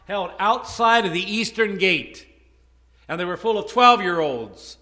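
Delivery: loud, rising sermon build; preaching. Acted. Preacher rousing the congregation, loud and building.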